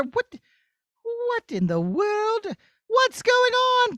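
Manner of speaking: high, slow, bewildered; startled. Acted. Princess finding a boy trapped, bewildered. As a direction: gasp out the confusion.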